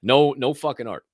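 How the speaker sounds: normal talking voice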